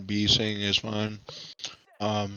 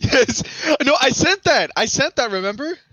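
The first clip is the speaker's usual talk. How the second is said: high, laughing protest; excited; 5 dB louder than usual. Real, not acted. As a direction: squeal it out, laughing.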